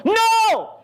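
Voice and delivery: high-pitched drawn-out yell; imitating an appalled yell; retold yell. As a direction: yell it in protest, pitch leaping way up. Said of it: "literally yelled".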